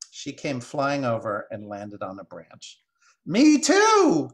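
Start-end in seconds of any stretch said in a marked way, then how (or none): 3.2-4.3 very high, very loud bird screech; shrill jeer. Acted. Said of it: "came a shrill jeer from even higher".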